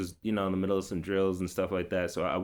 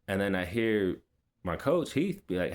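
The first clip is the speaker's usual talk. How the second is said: normal talking voice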